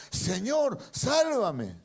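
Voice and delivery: sinking Pedro crying for help; desperate cry. Acted. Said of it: "dio voces diciendo".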